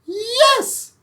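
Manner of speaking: high loud yes; high loud witch exclaiming; witch's yes. Acted. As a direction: say it high and bright. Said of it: "said the witch".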